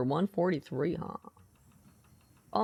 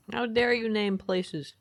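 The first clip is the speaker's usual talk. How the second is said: ordinary delivery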